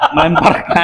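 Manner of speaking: high, laughing voice; word squeezed through high laughter. Real, not acted. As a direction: crack up while getting the word out. Cracks up mid-answer.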